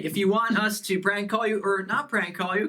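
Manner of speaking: ordinary talk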